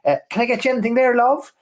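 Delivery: high, quick cabin-crew offer; air hostess. Retold or imitated. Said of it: "the air hostess saying".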